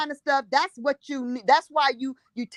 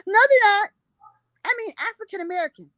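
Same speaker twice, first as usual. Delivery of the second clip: much higher prim woman's voice; high, fussy lady voice; 10 semitones higher, faster than usual. Retold or imitated.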